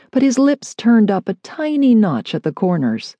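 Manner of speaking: ordinary delivery, nothing marked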